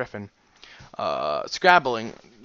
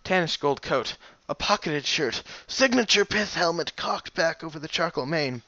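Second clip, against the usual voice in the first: usual speaking voice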